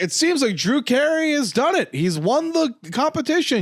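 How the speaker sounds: light parody of a broadcast commentator; mock sports panel. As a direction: hype the winner like a TV pundit. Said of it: "It's like".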